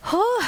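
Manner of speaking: high, loud puffing whew; relieved whew. Real, not acted. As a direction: blow out a winded whew. Coach exhales after hard set.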